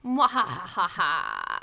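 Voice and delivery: slow evil villain cackle; mad scientist. Acted. Villain laugh after "endure another episode.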